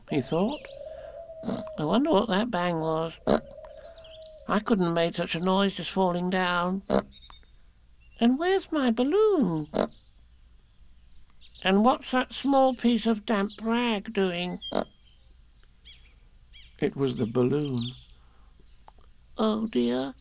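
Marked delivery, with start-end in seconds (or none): 1.8-14.6 high little voice, wondering aloud; Piglet, puzzled. A narrator's reading. Piglet wondering about the bang after falling, high and puzzled. 19.4-20.1 high little voice of dismay; small, high-pitched upset; Piglet, dismayed. A narrator's reading. Piglet finding his burst balloon, high and dismayed. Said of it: "said Piglet".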